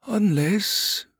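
high, drawn-out, tentative wizard; hesitant. Acted. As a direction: venture it reluctantly. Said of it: "the other wizard said hesitantly".

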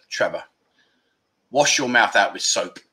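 a hint of a stern mum at a sweary son. Mother scolding him retold.

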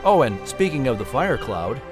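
ordinary delivery, nothing marked

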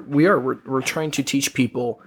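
ordinary delivery

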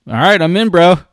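loud buddy-style "I'm in"; eager agreement. Retold or imitated. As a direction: jump in all at once, fired up. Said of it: "I said".

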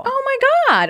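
high, loud, delighted disbelief; amazed. Real, not acted. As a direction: gasp it out, truly amazed. Reacts to the story.

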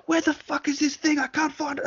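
very high mock-exasperated squeal; frantic searching clerk. Clerk alone in the stockroom, hammed-up high frantic complaint. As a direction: play a panicked clerk cursing in a high voice. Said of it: "once you get at the back, being like".

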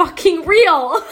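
slow punchline breaking into laughter; emphatic then laughing. Real, not acted. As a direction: laugh through the last word.

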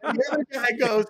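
laughing, a little fast. Real, not acted. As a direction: still laughing, words spill out. Laughing while telling the story.

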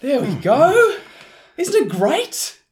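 high, slow and far-off proud voice. Acted. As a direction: show off the couch in a high, slow voice. Proud of cheap couch.

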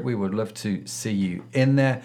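ordinary delivery

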